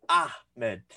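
"Ahmed" as an open Ah, then med, no K; Ahmed without K. Shown as a demonstration. Co-host correcting the host, "Ahmed" with no K sound. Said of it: "No, too much K".

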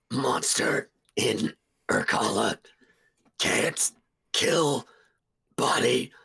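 low, slow, broken monster croak; distorted half-robot voice. Acted. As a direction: rasp it out like a half-human machine. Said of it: "very distorted, semi-mechanical, semi-human speech".

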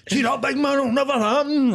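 high, hammed-up taunting impression of a jittery guy in a lift; mocking Marty Pello. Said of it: "Backwards and forwards on the spot".

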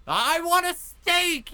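high, slow, drawn-out wail for steak; desperate cry. Retold or imitated. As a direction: whine it like a starving drunk. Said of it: "he's like".

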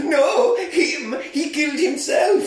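hammed-up squeaky waiter, amused; ridiculous waiter voice. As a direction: blurt it in a silly high-pitched voice. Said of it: "he's got the most ridiculous voice".

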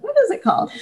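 mock-baffled, pitch shooting up; squeaky flustered what-is-it; puzzled self-quote. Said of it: "I'm like".

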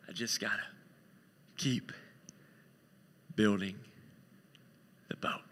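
very soft, low, long pauses between words; hushed, halting, dogged resolve; quiet perseverance. Acted. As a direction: plod on word by word, nearly under your breath. Acting Noah plodding on again.

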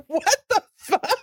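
high, laughing what the fuck; cracking up in disbelief. Real, not acted. Reacting to the abrupt no, laughing in disbelief. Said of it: "I was like".

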